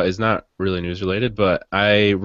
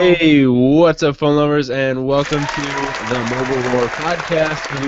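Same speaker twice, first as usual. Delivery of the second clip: bright, drawn-out podcast greeting; energetic intro; 6 semitones higher, 3 dB louder than usual. Acted. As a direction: open the show with big energy. Podcast host opening greeting.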